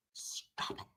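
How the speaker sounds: gasping, breathless whisper of disbelief; gasp, then barely breathe it. Real, not acted. Reacting to the jury's story, gasp and whispered disbelief.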